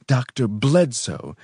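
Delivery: disdainful emphasis; distaste. A narrator's reading. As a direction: draw out the name with distaste. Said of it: "he said with distaste".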